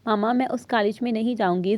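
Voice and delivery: ordinary delivery, nothing marked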